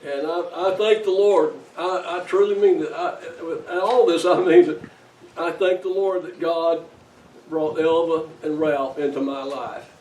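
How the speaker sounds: loud, earnest pulpit thanks; preaching at full force. Real, not acted. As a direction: proclaim it with full heart. Pastor's heartfelt loud tribute.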